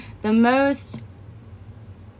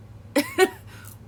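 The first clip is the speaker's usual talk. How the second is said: a squeaky high laugh; high giggle. Real, not acted. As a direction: giggle at your own reaction. Laughs at her own shock.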